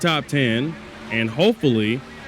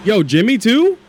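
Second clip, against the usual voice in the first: louder, higher surprised outburst; 8 semitones higher, 6 dB louder than usual. Real, not acted. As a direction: blurt it in real surprise. Another car blows a tire.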